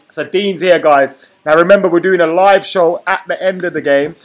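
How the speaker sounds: loud excited announcement. Real, not acted. Excitedly announces Dean's arrival.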